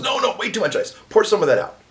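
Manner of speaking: high, loud fussy correction; retold priest telling the seminarian to pour out ice, high mock scold. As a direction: fuss like a particular bartender.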